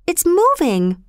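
high-pitched startled exclamation; astonished cry. A narrator's reading. Girl seeing a tree move down the hill, astonished cry. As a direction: cry out in amazement, pitch jumping up. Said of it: "cried Bobby".

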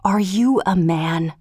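slow, restrained angry question; softly but angrily. A narrator's reading. Wife challenges her husband in his ear, restrained anger. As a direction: put quiet anger into each slow word. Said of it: "she softly but angrily asked".

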